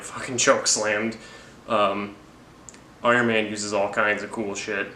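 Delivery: normal talking voice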